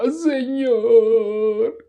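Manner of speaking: very high, sustained mock-pious cry; weird prayer voice. Preacher parodies whole-hearted prayer to the Lord, weird prayer voice. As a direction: stretch it out in exaggerated fake fervor. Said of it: "una voz toda rara".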